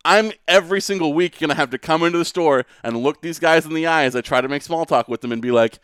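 usual speaking voice

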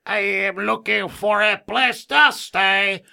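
put-on high, loud, slow Fantasy Island accent; talking cow with a drawn-out, high Tattoo accent; Tattoo's accent. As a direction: plead slowly in a hammed-up Fantasy Island accent. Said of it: "He had a little accent".

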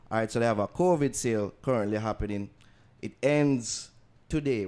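upbeat announcer ad read. Acted. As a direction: sell it like a radio spot. Podcast ad read.

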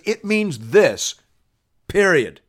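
high, emphatic ruling, slowing on the verdict; authoritative pronouncement. Retold or imitated. Preacher voices Jesus settling the arguments, authoritative and emphatic. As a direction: lay it down as final, loud and high.

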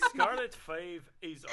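put-on slow, laughing ogre accent; Shrek accent. Reading on from the wine label, Shrek accent. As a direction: read slowly in a rough Shrek-style accent. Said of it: "This is [name] as Shrek".

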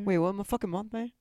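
mock Aussie bloke, Australian accent; hammed-up Australian accent, tossing in mate; light put-on Australian accent. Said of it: "talk like a fucking Australian".

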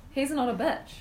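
deadpan through a laugh; trying to stay serious while laughing; serious but laughing. Real, not acted. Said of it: "You said it so seriously, you're laughing".

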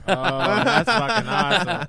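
laughs through an amused reaction; slow, delighted, cracking up; real laugh of delight at a name. Real, not acted.

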